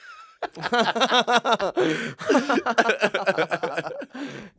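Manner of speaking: loud, high burst of laughter; big laugh. Real, not acted. Laughing at the security guard joke.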